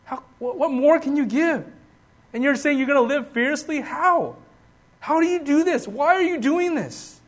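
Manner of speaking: high-pitched, disbelieving demand for an answer; incredulous questioning. Acted. Preacher voices a doubter asking Paul why he keeps giving, incredulous. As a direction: plead for an explanation, pitch climbing.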